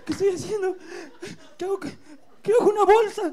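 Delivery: shrill high panicked yell; frantic high panic. Acted. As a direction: lose it in self-panic. Panicked self-reproach.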